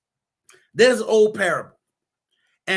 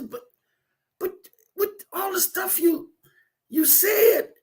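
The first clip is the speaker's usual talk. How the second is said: dying rabbit; 10 semitones higher than usual. Acted. Dying rabbit asks the snake about his promises, high and faltering. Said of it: "as he's laying there dying".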